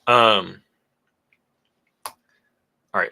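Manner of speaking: normal talking voice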